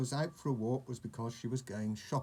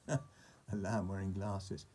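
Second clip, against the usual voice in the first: plain reading voice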